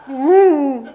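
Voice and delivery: high, loud "ooh"; a sudden high ooh of alarm; startled "ooh". Retold or imitated. Preacher recalling his shock at a teenager's Mohican, startled ooh.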